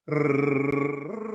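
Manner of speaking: sustained rolled r on a sung pitch; rolled r on a note. Shown as a demonstration. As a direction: hold a buzzing r on one steady note. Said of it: "Try that one".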